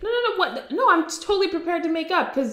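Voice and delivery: high, rushed protest with a laugh; jittery high denial; nervous Johnny. Retold or imitated. Johnny nervously insisting to Ronnie, high and laughing. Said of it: "And Johnny's like".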